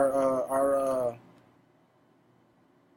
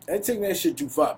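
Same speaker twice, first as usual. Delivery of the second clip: ordinary talk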